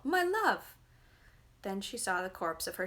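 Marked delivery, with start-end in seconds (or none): 0.0-0.8 calling out. A narrator's reading. Mother calls out to daughter.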